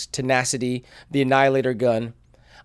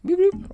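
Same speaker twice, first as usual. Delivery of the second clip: squeaky high droid beep; robot beeps. Pretend. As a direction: chirp it like a battle droid. Droid noises, Separatist droids.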